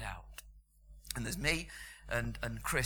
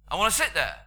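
put-on loud, high demand from a frail old lady; old lady on the bus ordering Chris out of his seat, loud and high; 7 semitones higher, 12 dB louder than usual. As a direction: bark it at the seated man, imperious and loud. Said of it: "she points to Chris and says".

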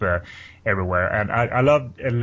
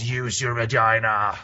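slow, put-on tempting voice; lightly voiced temptress urging; seductive character voice; slower than usual. Voicing the free spirit.

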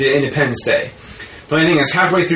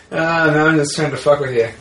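ordinary delivery

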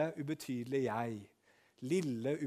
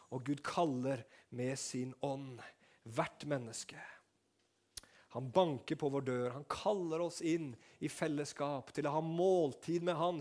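usual speaking voice

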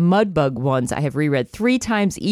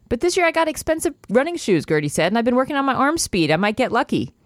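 raised, hopeful eager Gertie; Gertie's voice; 7 semitones higher than usual. Acted. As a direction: lift it up high with a bit of pride.

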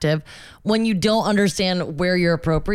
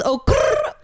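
high growl with a trill. Retold or imitated. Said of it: "Yeah, she does".

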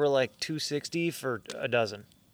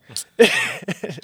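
loud laugh; 6 semitones higher, 8 dB louder than usual. Real, not acted. Laughing at own impression.

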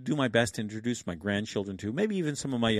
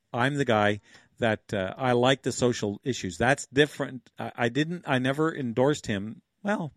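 ordinary talk

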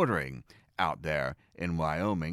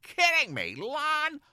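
much higher and louder, thrilled; excited outburst; 8 semitones higher, 5 dB louder than usual. Retold or imitated. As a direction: burst out with delight. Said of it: "I said".

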